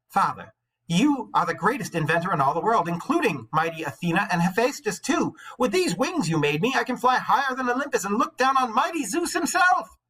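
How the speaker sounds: high, loud, swaggering brag; proud boast. Acted. Icarus bragging to his father mid-flight, proud loud boast. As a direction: puff up with pride and crow it. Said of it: "his heart bursting with ambition and pride".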